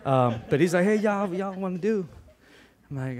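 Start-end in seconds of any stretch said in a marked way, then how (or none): 0.8-2.1 higher-pitched mock Texan; Texan accent. Preacher mimicking his Texas brother's greeting, Texan accent. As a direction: put on your brother's Texan talk. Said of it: "seeing a Mexican talk like that".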